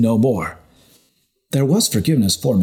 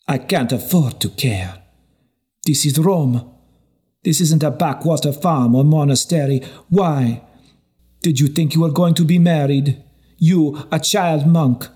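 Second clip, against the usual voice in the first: scornful woman voice; 6 semitones higher than usual. A narrator's reading. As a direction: sneer at the naive young monk. Narrator voices scornful Felicia.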